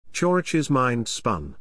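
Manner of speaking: ordinary delivery, nothing marked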